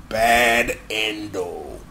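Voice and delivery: very low, drawn-out doom voice; soft, deep game-over announcement; ominous announcer. Retold or imitated. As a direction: intone it deep and very slow. Intones a doom announcement after sting.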